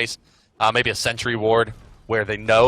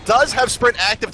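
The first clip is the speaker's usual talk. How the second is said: higher, quick calls of the fight; rapid-fire fight commentary, pitched up; excited play-by-play; 6 semitones higher than usual. Real, not acted.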